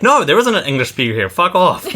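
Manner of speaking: high-pitched, hammed-up brush-off; mock indignant refusal. Imagined bank staff rebuffing English-only foreigners, mock indignant. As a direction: play at being fed up, pitched well up.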